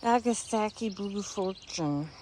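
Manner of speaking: talking with medicine in mouth; words around a pill in the mouth; mouth full. Real, not acted. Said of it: "I have migraine medicine in my mouth".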